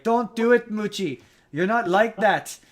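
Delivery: badly faked Irish brogue pleading with him; terrible Irish accent. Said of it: "I'm doing a terrible Irish accent".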